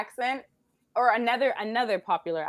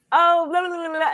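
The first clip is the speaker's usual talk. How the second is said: high, quick gabble of a Londoner; fast Londoner gabble; 10 semitones higher, 7 dB louder than usual. Retold or imitated. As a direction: rattle it off, too fast to follow. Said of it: "they'd be like".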